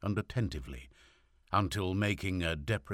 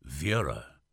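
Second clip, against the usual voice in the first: softened harsh voice; 3 semitones lower than usual. A narrator's reading. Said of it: "trying to soften his loud, harsh voice".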